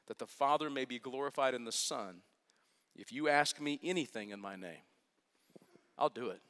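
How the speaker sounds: soft, low, measured reading of scripture. Acted. Pastor reads Jesus' words to the congregation, softly solemn. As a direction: read the words as holy text, gently.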